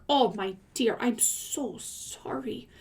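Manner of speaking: slow, hushed "I'm so sorry"; whispered sympathy. Real, not acted. Consoling the reader whose cat died, slow tender whisper. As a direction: whisper it gently, like a condolence.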